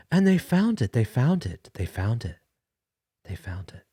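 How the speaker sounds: high and strained at first, sinking to a whisper; caller's voice cracking down to a whisper; emotion fading. Real, not acted. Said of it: "show a little bit of emotion".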